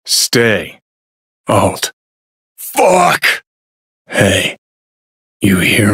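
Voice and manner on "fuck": high, sharp exclaimed curse; outburst. Acted. Cursing to himself after getting no answer, frustrated outburst. Lone curse, raised pitch.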